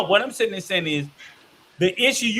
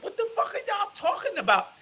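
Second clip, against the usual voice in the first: incredulous outburst; 10 semitones higher, 8 dB quieter, faster than usual. Retold or imitated. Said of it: "I'm like".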